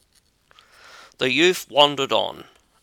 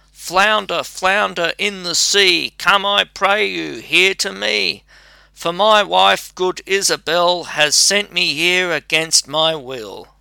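raised high, calling out over the storm; crying out to the sea; 6 semitones higher than usual. Acted. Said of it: "Then he cried".

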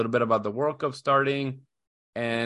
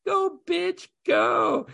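put-on much higher little-kid yell; shrill kid shout imitation; much slower than usual. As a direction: shout it like a gleeful toddler. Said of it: "my son out of nowhere just says".

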